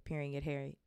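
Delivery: usual speaking voice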